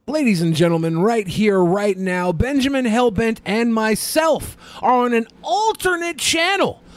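rising hype promo announcer. Acted. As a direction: sell it like an announcer.

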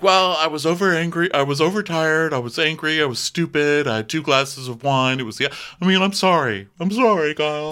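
high, pleading mock-weepy apology; put-on upset housewife; mock tearful. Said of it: "And Kathy's crying".

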